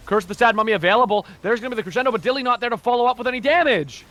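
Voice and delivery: loud, high fight call; live play-by-play. Real, not acted. Caster calls the Crescendo as it lands, excited play-by-play. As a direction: build excitement as the big play unfolds.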